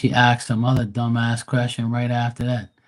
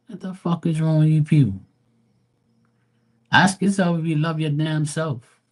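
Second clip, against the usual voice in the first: exasperated rant; 6 semitones higher than usual. Real, not acted. Ranting at chat question askers.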